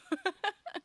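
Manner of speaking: squeaky burst of laughter; high laugh. Real, not acted. Laughs at neighbors story.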